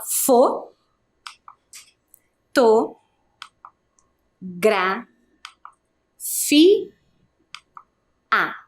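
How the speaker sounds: "fo, to, gra, fi, a" with long pauses between syllables; separated syllables. Shown as a demonstration. Teacher cuts the word up along with pupils, separated syllables. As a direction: cut the word into isolated, evenly placed pieces. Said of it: "vamos cortando os pedacinhos junto".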